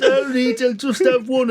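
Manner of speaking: high-pitched protesting drinker impression; Gaza. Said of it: "he goes".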